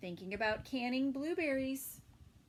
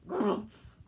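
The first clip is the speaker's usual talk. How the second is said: mild bear grumph; 2 semitones lower, 6 dB louder than usual. Acted. A hint of mother bear grunting in surprise. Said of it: "she cried".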